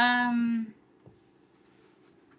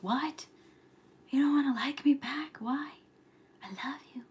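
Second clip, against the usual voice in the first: high, hammed-up pleading; mock heartbreak; 5 semitones higher than usual. Streamer mimicking signs rejected by a crush, pleading. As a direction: imitate a spurned admirer in disbelief.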